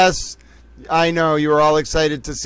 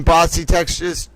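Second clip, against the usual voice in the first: usual speaking voice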